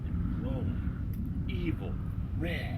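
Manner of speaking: low hushed ghost-story voice; hushed spooky telling. A narrator's reading. Narrator hushing a scary campfire tale, barely voiced. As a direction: draw listeners in with a creepy hush.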